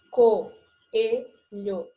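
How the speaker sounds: "Coelho" loud, split into three syllables; sílaba por sílaba. Shown as a demonstration. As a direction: sound out "Coelho" with a gap after each syllable. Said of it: "trissílaba". ("Coelho" em voz alta, separando as sílabas)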